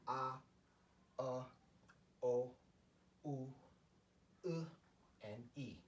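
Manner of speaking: "ah, uh, o, u, u, e" as separate Korean vowels; Korean vowel sounds. Shown as a demonstration. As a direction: sound out each vowel alone, pausing between. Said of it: "sound structure".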